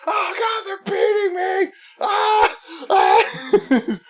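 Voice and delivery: high, hammed-up cry of being beaten; mock victim wail. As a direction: parody his fake panic. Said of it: "And he's like".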